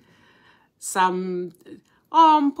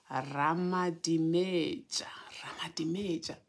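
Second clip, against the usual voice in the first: whispered name; 3 semitones lower, 8 dB quieter than usual. Real, not acted. Savouring a beautiful name.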